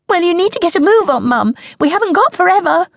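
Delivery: high, fast, loud hurry-up; impatient child. Acted. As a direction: nag like a cheeky child.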